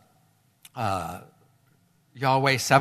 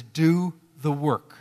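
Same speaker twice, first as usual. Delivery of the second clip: "Do the work" stretched out slowly, every word pressed; slow emphatic command; much slower than usual. Acted. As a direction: spell out each word of "Do the work". Preacher's repeated exhortation.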